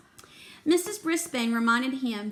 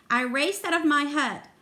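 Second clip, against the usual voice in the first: ordinary delivery, nothing marked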